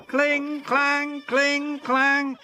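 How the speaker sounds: high, sing-song cheeky chant; mischievous trucks teasing; giggling trucks. Acted. Trucks giggling at James, high sing-song chant. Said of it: "they giggled".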